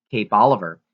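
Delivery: plain reading voice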